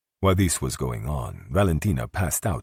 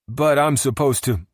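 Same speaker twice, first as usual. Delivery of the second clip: protesting bartender; 12 semitones higher, 6 dB louder than usual. A narrator's reading. Bartender protests being sent off.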